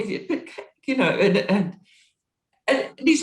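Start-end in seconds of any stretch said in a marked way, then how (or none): none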